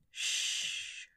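soft whispered shush; quiet him with a breathy shh; hushing. A narrator's reading. Sokka calming his bound lover with a soft hush. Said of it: "Sokka hushed him".

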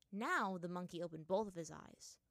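ordinary delivery, nothing marked

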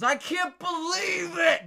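high, drawn-out mock wail; retold outraged whine. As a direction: stretch it into a disbelieving whine. Stall man still outraged.

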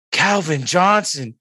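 starstruck. Retold or imitated. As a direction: blurt his name, dazzled. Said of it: "starstruck at the time".

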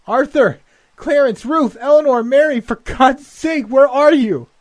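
very high, loud calls into the night; screaming names. Acted. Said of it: "ran screaming toward the still clanging bell".

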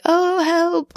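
high, drawn-out mock plea at normal volume; half-hearted cry for help. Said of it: "she kind of does".

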